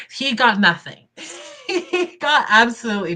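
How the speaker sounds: can't hold back the laugh. Real, not acted. To viewers about the ex-husband, laughing. Gloating over ex's lost divorce.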